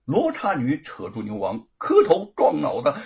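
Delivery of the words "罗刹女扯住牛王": ordinary delivery, nothing marked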